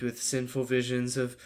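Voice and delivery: slow, halting parody prayer; mock lustful prayer. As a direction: pray haltingly, stretching each word. Parody prayer slipping into lust.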